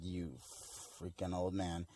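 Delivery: low-volume grumble under the breath; muttered resentment. Retold or imitated. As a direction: imitate a quiet, private curse. Zamasu's hidden resentment, acted.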